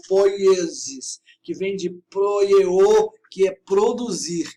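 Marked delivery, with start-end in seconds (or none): 0.1-1.2 "poiesis" very slow and drawn out; Greek word. Shown as a demonstration. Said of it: "Lembre-se do nome grego". 2.1-3.1 "proieo" slow and loud, syllables drawn out; Greek word. Shown as a demonstration. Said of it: "Lembre-se do nome grego".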